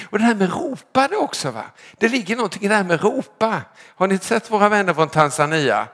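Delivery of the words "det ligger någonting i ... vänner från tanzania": normal talking voice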